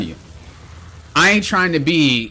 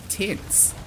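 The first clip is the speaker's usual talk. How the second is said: whispered tense; 7 semitones higher, 10 dB quieter than usual. Real, not acted. Describing her own tension.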